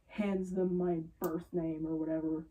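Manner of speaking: usual speaking voice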